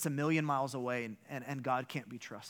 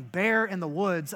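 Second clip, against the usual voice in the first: yelling; 4 semitones higher, 8 dB louder than usual. Retold or imitated. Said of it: "so now I'm yelling".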